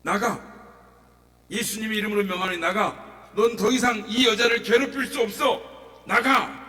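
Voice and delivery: loud, high command to leave; preacher ordering the spirit out in Jesus' name; commanding shout. Retold or imitated. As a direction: cast the demon out, full voice. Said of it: "소리를 칩니다".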